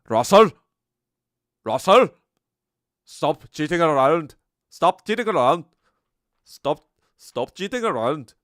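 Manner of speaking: put-on loud, high scolding in Indian accent; hammed-up Indian accent, calling out sharply; Russell Peters. Doing a comedian's bit, yelling at Russell in Indian accent. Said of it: "work on my Indian".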